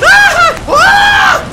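very high, very loud scream; full panic shriek; panicked scream. Real, not acted. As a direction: shriek as you get ambushed. Ambushed by enemies.

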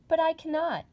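surprised exclamation. Acted. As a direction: blurt it out in shock. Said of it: "exclaimed Dorothy, greatly surprised".

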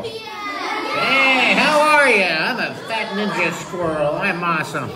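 high, loud cartoon greeting, then easing down; fat ninja squirrel. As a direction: burst in as a cocky cartoon squirrel. Introduces himself as fat ninja squirrel.

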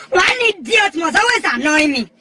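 ordinary talk

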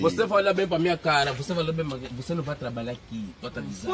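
quiet menacing warning to the woman; low quiet threat. Real, not acted. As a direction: drop it down and warn her.

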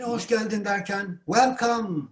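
high-pitched exclamation; exclaimed welcome. Shown as a demonstration. Teacher demonstrates greeting a guest, exclaimed. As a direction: cry it out as a greeting. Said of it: "Böyle haykırıyorsunuz".

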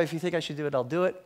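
ordinary delivery

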